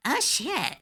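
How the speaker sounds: high, slowed shocked realization; dawning realization. Retold or imitated. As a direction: realize the monsters are people. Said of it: "that moment of going".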